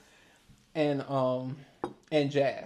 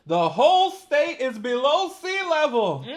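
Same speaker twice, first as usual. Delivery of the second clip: higher, louder mock-announcement; mock declaration; 10 semitones higher, 8 dB louder than usual. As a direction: belt out the myth in a high, loud voice. Reads out myth, mock outraged.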